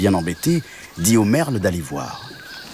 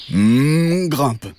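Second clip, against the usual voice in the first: higher cow voice with a long hum; cow character; 6 semitones higher, 4 dB louder than usual. A narrator's reading. The cow lets the mantis climb on, with a higher humming voice. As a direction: hum loudly, then invite as the cow. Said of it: "dit la vache".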